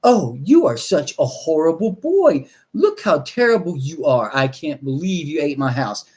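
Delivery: lightly voiced mock scolding, slowed; a hint of a disappointed owner; half-hearted mock scolding of the dog. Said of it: "looked at him and go".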